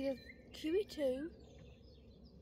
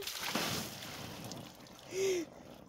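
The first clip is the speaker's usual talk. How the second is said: sharp intake of breath; a quick awed gasp; excited gasp; 3 semitones higher than usual. Real, not acted. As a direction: gasp in delight at the fish. Proud catch reveal.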